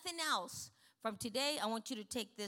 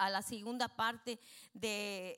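normal talking voice

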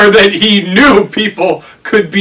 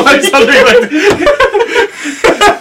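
high, drawn-out giggle through words; laughing; 9 semitones higher, slower than usual. Real, not acted. Finishing the internet joke for a friend, laughing. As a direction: let the laugh take over the end.

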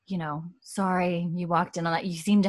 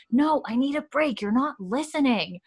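higher-pitched, mildly exasperated retort; acted protest; 6 semitones higher than usual. Daughter argues with her mother, mildly exasperated imitation. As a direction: push back as an unheard daughter.

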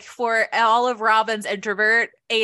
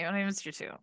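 grudging mumble; 12 dB quieter, faster than usual. Retold or imitated. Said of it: "please don't talk to me".

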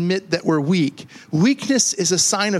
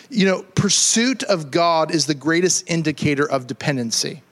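ordinary talk, nothing marked

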